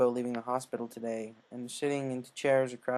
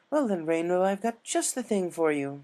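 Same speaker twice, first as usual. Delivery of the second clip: higher, louder, pleased lady; Rarity voice; 6 semitones higher, 5 dB louder than usual. Acted. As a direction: announce it with a proud high flourish.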